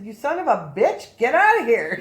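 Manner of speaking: acted-out indignant shout; angry banishing. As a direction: order the intruder out forcefully. Acting out ordering the presence away.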